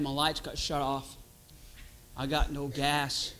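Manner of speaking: a worn-out, broke believer complaining to God; weary churchgoer. Acted. Voicing a discouraged, broke churchgoer.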